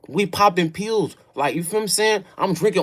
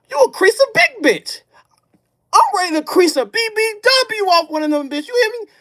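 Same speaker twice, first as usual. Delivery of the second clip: wild hype; 10 semitones higher than usual. Retold or imitated. Hyped rant about the drug.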